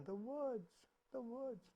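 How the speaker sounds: reply as a faint, fading echo. Acted. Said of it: "the voice comes back again".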